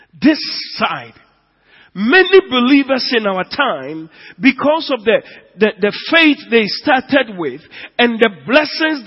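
loud, high preaching build; rising into a loud preaching climax; full-force preaching. Acted. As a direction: thunder it out to the church.

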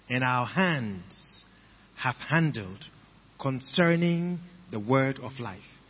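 slow, soft reading with long pauses between phrases. Shown as a demonstration. Rereading the verse slowly to the congregation, quiet. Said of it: "Let's read it again slowly".

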